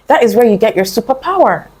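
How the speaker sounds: normal talking voice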